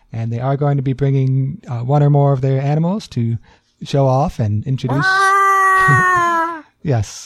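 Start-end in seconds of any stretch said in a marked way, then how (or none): 5.1-6.6 high squealing animal cry; animal noise. Acted. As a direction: imitate a wild animal call. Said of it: "it was a good impression".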